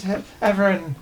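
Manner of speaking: ordinary talk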